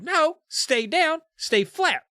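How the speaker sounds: shriek. Acted. Heroine stopping Alicia from getting up, a high shriek. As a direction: shriek it out in alarm. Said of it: "I shrieked".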